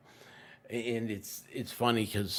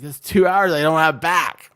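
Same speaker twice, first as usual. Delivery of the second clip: loud, exasperated and higher; frustrated outburst; 6 semitones higher, 13 dB louder than usual. Retold or imitated. Fed up with the laptop, a loud exasperated outburst. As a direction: blurt it out, fed up with wasted hours. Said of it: "I was like".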